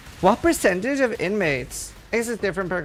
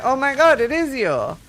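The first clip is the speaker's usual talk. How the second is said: acted amazement; 8 semitones higher than usual. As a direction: exclaim it as if amazed to recognise a friend. Acted amazement at fake recognition.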